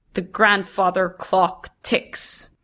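plain reading voice

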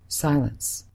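usual speaking voice

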